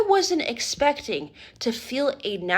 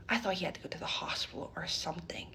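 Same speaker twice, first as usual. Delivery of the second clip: whispered; 5 semitones lower, 12 dB quieter, faster than usual. Real, not acted. Whispered dramatic aside.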